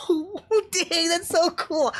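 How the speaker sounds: high, delighted gush with a laugh; laughing mock awe; excited reaction. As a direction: react like a thrilled fan. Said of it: "I was like".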